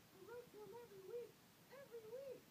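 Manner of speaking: very high, fast, rising cry; shouting. A narrator's reading. Mother defending her letters, high shout. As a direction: shriek it in protest. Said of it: "And Mother shouted".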